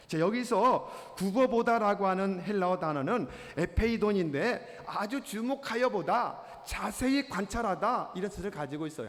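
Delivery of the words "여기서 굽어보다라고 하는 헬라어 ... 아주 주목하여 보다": ordinary talk, nothing marked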